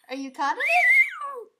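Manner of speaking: high, loud, slow playful call. Pretend. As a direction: tease the dog with a big voice. Playful to dog.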